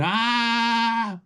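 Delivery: sudden high cry of rage; retold scream. Desmond punching the cell door, high frustrated scream. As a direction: let out one frustrated yell. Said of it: "yelling and screaming".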